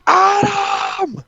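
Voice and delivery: high, ringing call of the name; shouted name. Retold or imitated. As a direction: yell it like calling across a ring. Calling out the name.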